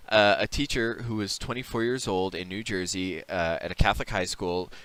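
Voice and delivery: ordinary delivery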